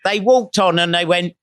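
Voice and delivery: ordinary talk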